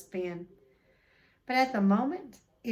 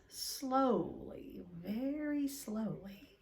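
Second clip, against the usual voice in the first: slow, drawn out; 4 dB quieter, much slower than usual. A narrator's reading. Snake opening its eyes.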